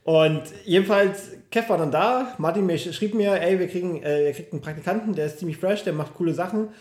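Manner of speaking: ordinary delivery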